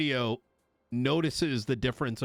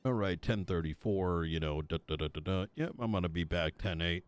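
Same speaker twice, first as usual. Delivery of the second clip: lower, flat and unhurried radio check-in, lower pitch; bored radio call; 7 semitones lower, 4 dB quieter than usual. Shown as a demonstration. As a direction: mumble it like you haven't had coffee. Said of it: "you're hearing".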